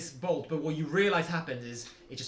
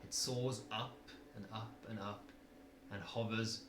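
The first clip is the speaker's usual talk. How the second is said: dramatic narration; 5 semitones lower, 7 dB quieter than usual. A narrator's reading. GM describes spell dramatically.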